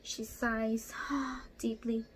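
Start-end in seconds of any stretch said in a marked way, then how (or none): none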